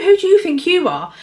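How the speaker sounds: indignant. Retold or imitated. Imagined retort to anaesthetist.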